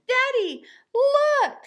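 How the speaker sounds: put-on high, loud little girl calling out; excited child. A narrator's reading. Little girl calling to her father in the store, high and loud. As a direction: shout it up like an eager kid.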